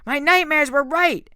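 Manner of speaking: high, loud, tearful yell; a betrayed kid screaming at him; furious scream. A narrator's reading. Nico screams at Percy.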